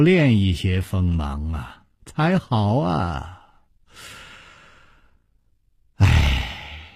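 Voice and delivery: slow, low, soft musing with sighs; leisurely, sighing monk. A narrator's reading. Monk Wuhua sighing over Chu Liuxiang, slow and unhurried. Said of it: "悠悠地说".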